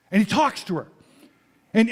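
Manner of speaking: ordinary delivery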